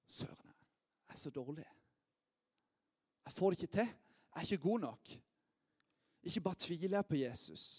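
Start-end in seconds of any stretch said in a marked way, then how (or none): 0.1-4.6 whispered self-reproach, barely audible; despairing whisper. Retold or imitated. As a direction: breathe it out as a crushed admission. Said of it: "Peter bare innser". (hvisket, fortvilet selvbebreidelse)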